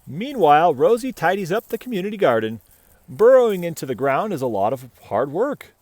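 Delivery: bright, even read-aloud voice; story narration. A narrator's reading. Reading a picture book to children, bright storytelling. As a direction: read the tale gently to little listeners.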